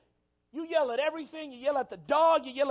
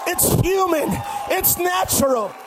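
full-force preaching shout; 3 semitones higher, 5 dB louder than usual. Real, not acted. Preacher urging the congregation to let it out, shouting. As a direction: shout it out to a whole church. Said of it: "I'm shouting to keep from going crazy".

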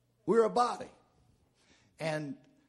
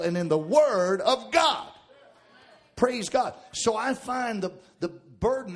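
preaching; 5 semitones higher, 11 dB louder than usual. Acted. Preaching at full force.

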